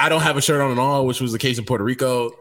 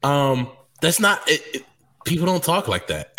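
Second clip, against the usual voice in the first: ordinary delivery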